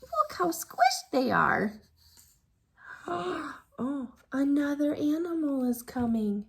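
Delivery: usual speaking voice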